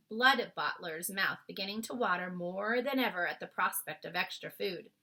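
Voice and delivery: ordinary delivery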